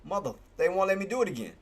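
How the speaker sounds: ordinary delivery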